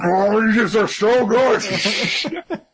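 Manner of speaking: high, drawn-out mock moan of delight; exaggerated squeal through laughter; hammed-up food rapture. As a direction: wail with bliss over the noodles. Voicing character devouring udon.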